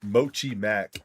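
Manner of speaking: "Mochi Mac" said the plain American way, MO-chee MACK; flat American "Mochi Mac", no tones; American pronunciation. Shown as a demonstration. Showing viewers the American way, plain American pronunciation. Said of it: "We say it the American way".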